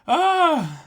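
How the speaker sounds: high, strained voice fading from tears; pleading through leftover sobs; still tearful. Real, not acted. Host begging his co-host to read, still tearful. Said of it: "without crying".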